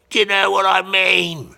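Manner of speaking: loud, high yell; shouted punchline. Real, not acted. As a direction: holler it to drive the joke home. Shouted punchline after crude comparison.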